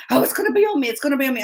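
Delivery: freaking out. Retold or imitated. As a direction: imitate a fretting child. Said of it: "she's freaking out".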